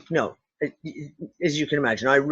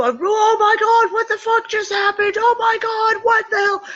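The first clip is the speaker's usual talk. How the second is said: panicked freak-out; 4 dB louder than usual. Retold or imitated. Remembering how she'd have reacted years ago, high mock-panicked shouting. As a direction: shriek it like the world is ending, half in jest. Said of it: "you'd have been go".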